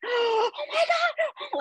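very high, drawn-out gasping squeal; retold screaming. Mother getting a shock phone call, re-enacted breathless scream. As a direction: re-enact being overcome, voice shooting up high. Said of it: "then I start screaming".

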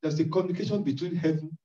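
usual speaking voice

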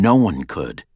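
plain reading voice

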